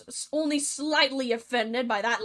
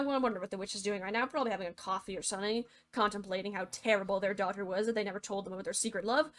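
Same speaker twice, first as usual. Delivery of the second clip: very fast, low and soft tangent; rapid aside; 3 semitones lower, 6 dB quieter, much faster than usual. Real, not acted. Narrator wondering what the witch is doing, rapid aside. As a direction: rattle it off under your breath.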